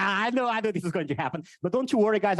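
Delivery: normal talking voice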